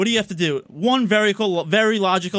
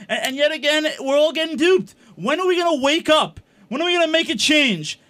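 ranting loud; 6 semitones higher than usual. Real, not acted. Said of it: "Why are you yelling?".

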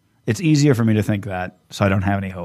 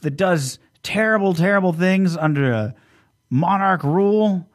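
much higher, incredulous ranting voice; hammed-up dictator rant; Hitler parody rant; 9 semitones higher than usual. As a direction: go way up, outraged and flustered. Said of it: "And he's like".

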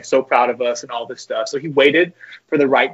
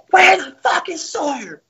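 very high, drawn-out mock coach yell; imitated furious coach, slow and piercingly high; Coach Garrido; 3 dB louder, slower than usual. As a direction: stretch it out in a strained high coach impression. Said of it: "He goes".

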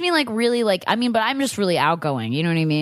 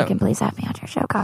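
whispered plea; 3 semitones lower, faster than usual. Pretend. Pleading to Conan, whispered.

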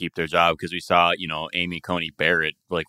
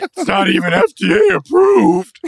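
high, loud mocking whine; mocking anti-vaxxer; 7 semitones higher, 5 dB louder than usual. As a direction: sneer it like a skeptic. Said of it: "the whole, like".

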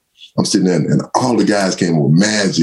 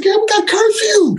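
much higher, hammed-up excuse to a girl; mock telling off; slower than usual. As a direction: refuse the night out with a playful whine. Telling girls about curfew.

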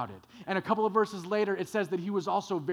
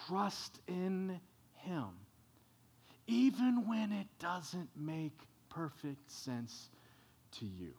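soft reassurance; 7 dB quieter, slower than usual. Real, not acted. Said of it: "personal reassurance after proverb".

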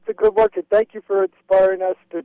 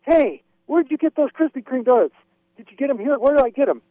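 higher-pitched eager retold questions; eager questioning; 6 semitones higher than usual. Caller re-enacting asking a man about donuts, eager and high. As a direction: imitate yourself pestering a stranger for the goods.

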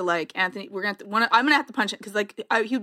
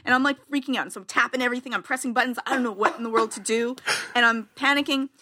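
normal talking voice

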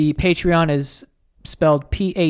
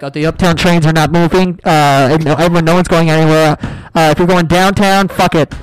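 mock PA announcement; 7 dB louder than usual. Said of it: "It's like".

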